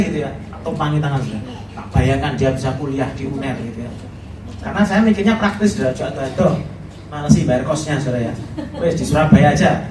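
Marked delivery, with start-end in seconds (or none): none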